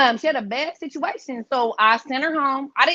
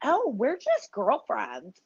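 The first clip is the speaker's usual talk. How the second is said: innocent excuse; 5 dB quieter, much slower than usual. Retold or imitated. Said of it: "the bitch has no accent".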